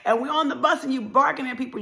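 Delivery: ordinary talk, nothing marked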